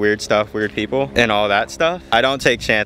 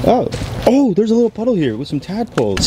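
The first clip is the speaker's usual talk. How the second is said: high, excited spotting; sudden delighted discovery; surprised; 10 semitones higher than usual. Real, not acted. Spotting tadpoles in puddle.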